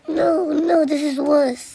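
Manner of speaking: hammed-up pretend alarm; alarmed cry. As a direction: cry out that things got worse.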